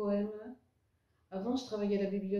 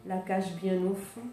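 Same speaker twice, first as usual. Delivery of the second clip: louder poem recitation, lines set apart by pauses; poem reading; 4 dB louder than usual. Acted. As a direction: recite your poem to an audience, phrase by phrase. Reads second poem aloud. (récitation de poème, vers bien détachés)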